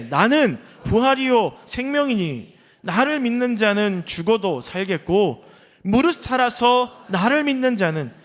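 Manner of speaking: loud, high, very slow congregational reading; scripture reading. Acted. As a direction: proclaim the verse phrase by phrase, drawn out. Reads scripture aloud to congregation.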